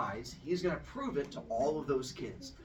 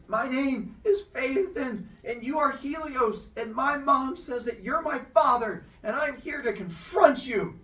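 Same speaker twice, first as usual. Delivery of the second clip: brave through fear; trembling bold speech; 10 semitones higher, 5 dB louder than usual. Acted. Boy facing his godly father, high and trembling. Scared boy addressing god.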